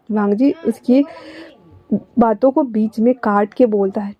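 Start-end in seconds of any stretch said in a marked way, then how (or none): none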